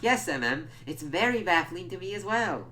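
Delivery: high, loud mock agreement; sarcastic high voice answering a character. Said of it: "I'm like".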